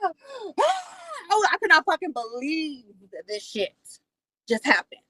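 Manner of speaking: high gasp, falling away in disbelief; gasp, then act out stunned disbelief; retold shocked disbelief. Telling viewers what he did in the driveway, acted-out shock.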